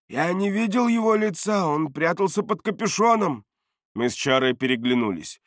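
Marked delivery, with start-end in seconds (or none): 0.1-3.4 high-pitched defensive answer; agitated protest. A narrator's reading. Бардак says he never saw the face, high agitated protest. Cornered man answers barked questions.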